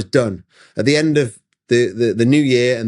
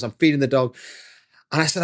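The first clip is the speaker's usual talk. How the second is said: ordinary talk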